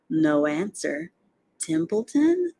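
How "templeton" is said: high, rising questioning call. A narrator's reading. Spider calling an absent rat, high rising call. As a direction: call the name upward, seeking an answer.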